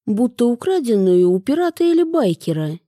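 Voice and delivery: plain reading voice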